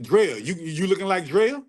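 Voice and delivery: ordinary delivery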